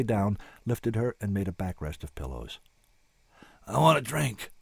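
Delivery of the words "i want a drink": hoarse voice. Acted. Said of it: "her own voice was so hoarse".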